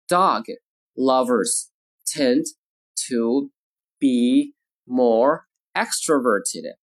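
slow, isolated words with clear pauses; word-by-word model. Shown as a demonstration. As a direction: dictate slowly to language learners. Teacher models sentence word by word.

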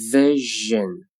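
"Vision" slowly with a held zh; slow careful "Vision"; drawn-out model. Shown as a demonstration. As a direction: stretch "Vision" out for learners. Teacher models word slowly, then normally.

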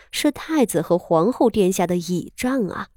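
plain reading voice

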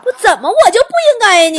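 提高嗓门不服地质问; 冲着想象中的人喊着反问; 大声质问. Acted. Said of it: "重复自问". (被嘀咕后大声不服地质问)

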